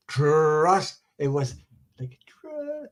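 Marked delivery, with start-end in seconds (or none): 0.1-1.0 firm, fully voiced TRUST; profound. Shown as a demonstration. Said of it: "it's not like a really profound". 2.0-2.9 soft, hushed TRU-, cut off short; barely-there whispered TRU-. Shown as a demonstration. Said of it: "a whisper".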